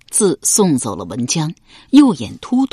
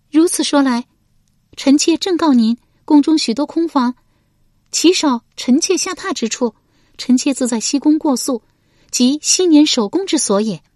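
high, firm female character voice; high-pitched wife standing her ground; noblewoman asserting herself; 9 semitones higher than usual. Acted. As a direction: state it formally in a high lady's voice.

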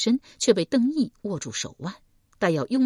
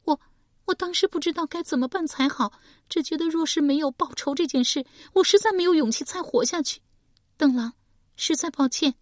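put-on higher, tearful young woman's plea; young woman weeping her apology, higher voice; crying; 11 semitones higher, faster than usual. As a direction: apologise through tears. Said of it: "史佩哭道".

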